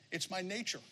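very soft, small-voiced shrug; scorpion's excuse. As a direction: play the scorpion owning up quietly. Scorpion's sheepish answer in fable.

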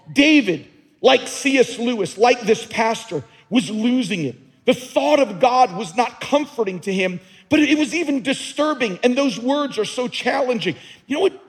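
preaching at full force. Acted. Pastor pressing the congregation, preaching at full force. Preacher building to emphatic peak.

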